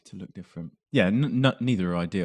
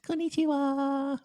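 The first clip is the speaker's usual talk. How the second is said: put-on very high, drawn-out girlish squeak; small Japanese girl; slower than usual. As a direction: greet like a tiny Japanese girl, voice pitched way up. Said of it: "with a small Japanese girl's voice".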